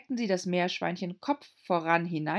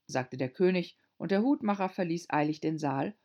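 usual speaking voice

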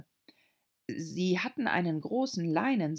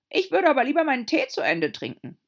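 quick, nervous, higher and louder; a fretful hatter pleading to finish his tea; anxious hatter; 8 semitones higher, 8 dB louder, faster than usual. A narrator's reading. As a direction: hurry it out, afraid of the queen. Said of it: "mit einem ängstlichen Blick".

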